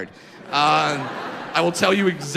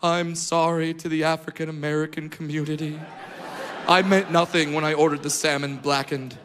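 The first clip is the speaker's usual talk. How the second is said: mock apology; 6 semitones lower than usual. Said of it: "press conference and be like".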